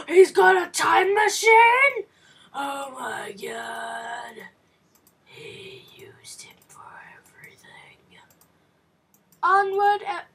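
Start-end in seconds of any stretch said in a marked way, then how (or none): 0.1-2.0 high gasp then loud amazed question; can't believe it, blurt it out; shocked gamer shout; stunned gasp. Real, not acted. Surprise at game character. 2.5-8.2 slow hushed whisper; stunned quiet whisper; awed whisper. Real, not acted. Awed at game reveal.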